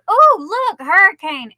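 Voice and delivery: high, loud mock excitement; mocking storm-watcher. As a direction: play the thrilled fool cheering the storm. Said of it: "crazy people stand outside and go".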